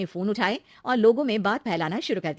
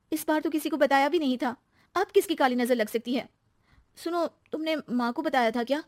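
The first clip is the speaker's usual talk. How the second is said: higher-pitched, suspicious questioning; dismayed young wife; 5 semitones higher than usual. Acted. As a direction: puzzle over the bad luck. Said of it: "हताश होकर आ जाते हैं". (परेशान, शक करती बहू)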